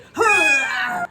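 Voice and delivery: high, loud taunting laugh; mocking loud cackle. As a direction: cackle at her like a bully. Mock cackle after threat.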